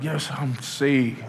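slow, quiet, churchy; drawn-out holy-sounding claim; mock pious saint. Preacher mocking churchgoers who claim holiness, slow and soft. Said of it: "you hear some of y'all like".

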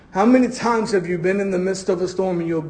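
ordinary talk

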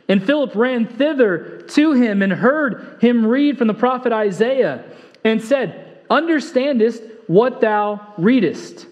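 slow, measured reading of scripture; solemn King James reading. Acted. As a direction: read the verse slowly and solemnly. Reading scripture aloud slowly.

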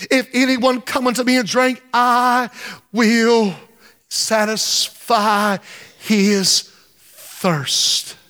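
very slow, word-by-word scripture quote; solemnly spaced-out declaration; deliberate proclamation. Acted. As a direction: let each word stand alone with pauses.

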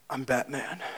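low, soft gravel-free Batman growl. Retold or imitated. Preacher mimics Batman to the congregation, low and soft. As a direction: say it like a brooding masked hero. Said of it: "he's just like".

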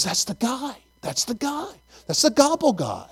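soft, high, eager imitation; hushed excited pointing-out. Daniel spotting the gobble guy at Walmart, hushed excitement. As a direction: point him out under your breath, high-pitched.

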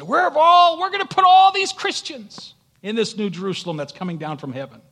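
sudden loud, high pulpit shout; booming preacher's outcry, easing toward the end; full-force preaching. Acted. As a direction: burst out loudly to the congregation.

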